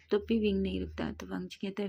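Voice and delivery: plain reading voice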